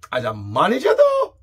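rising to a very high, loud "though"; comic doubt. Real, not acted. Host turns to Bruce's managerial record, comic high-pitched doubt.

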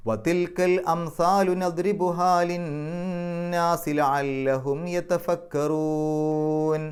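slow melodic tajweed chant; Quran recitation. Acted. Teacher recites the whole verse to the class, slow melodic chant. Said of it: "നമുക്ക് ഈ ആയത്തൊന്ന് പാരായണം ചെയ്യാം".

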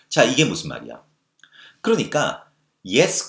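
ordinary talk, nothing marked

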